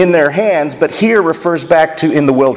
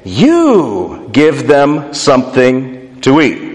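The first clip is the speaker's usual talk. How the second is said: slow command with a big stress on "You"; emphatic YOU; 2 semitones lower, slower than usual. Acted. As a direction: lean hard on "You", then space out the rest. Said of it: "is emphatic in the Greek".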